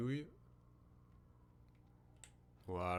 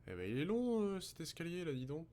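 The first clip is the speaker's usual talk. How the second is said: higher, exasperated remark; 8 semitones higher than usual. Real, not acted. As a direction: sigh at the endless stairs. Mocking the long staircase.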